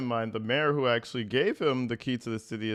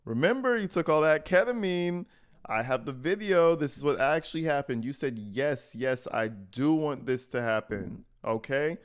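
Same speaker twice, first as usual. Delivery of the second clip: higher-pitched, insistent mock denial; mock gaslighting; 4 semitones higher than usual. Streamer mimicking someone denying to victims what happened, gaslighting. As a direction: talk them out of their own memory, pitched up. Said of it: "try to gaslight them real quick".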